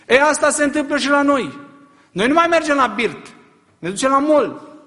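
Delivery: high, loud rising sermon cry; preacher driving home a rebuke; fiery preaching. Real, not acted. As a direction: press the question hard on the crowd. Preaching at full force.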